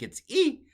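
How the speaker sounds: E said as a high, bright ee; modern Greek E. Shown as a demonstration. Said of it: "in modern Greek".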